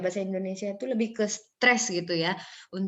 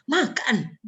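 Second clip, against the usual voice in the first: MAKAN stretched, swooping up then falling; swooping tune; 4 semitones higher than usual. Shown as a demonstration. Teacher showing no fixed rule, stretched rise-fall makan. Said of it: "menyebutnya harus".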